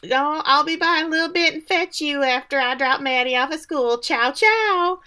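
high, singsong put-on phone voice; Missus Doubtfire act. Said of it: "doing her best Missus Doubtfire work here".

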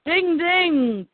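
high, bright ding ding; ding ding announcer. Real, not acted. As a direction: ring it like a prize bell. Announcing the winner.

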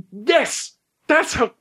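very high, squeaky burst of excitement; excited realization. Acted. Larry answers the Doctor's torch question, excited. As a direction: blurt it out as the idea lands.

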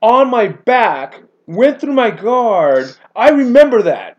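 high, loud eager quote; a keen fan recalling the match; retold eager voice; excited Dean Lister. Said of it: "he goes".

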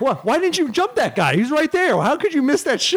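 high, laughing mock interviewer; mock pushy interviewer. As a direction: ham up a nosy broadcaster. Mocking interviewer during live match.

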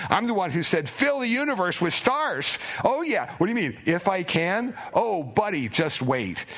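building gleeful eagerness; brimming with delight. Retold or imitated. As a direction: bubble over with delight at what's coming. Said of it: "Jesus just brimming with delight".

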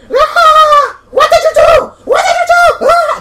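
very high, loud acted shriek; screaming. Gretchen screams at the waking orc, high frantic shriek. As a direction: shriek it in full panic. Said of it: "you hear a scream".

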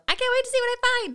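high, loud, bursting with eagerness; mock overeager reader who can't wait; eager excitement. Eager quote of rushing reader.

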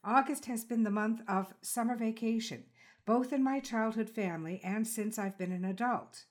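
measured, polished host read of a scripted introduction; calm, reflective announcer voice opening the show; podcast intro read. Acted. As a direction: read it like a composed radio essay, unhurried.

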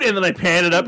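normal talking voice

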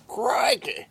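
high, drawn-out Australian exclamation; Australian accent. Retold or imitated. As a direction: imitate a cartoonish Australian accent. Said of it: "when he does an Australian".

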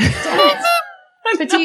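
very high, silly squeal; squeaky high voice. Pretend. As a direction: squeak it out playfully. Playful high voice for petite.

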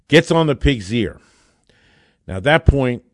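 normal talking voice

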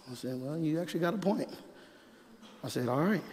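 hushed, sheepish admission; subdued concession. Retold or imitated. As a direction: give in quietly, almost under the breath. Retells own chastened reply.

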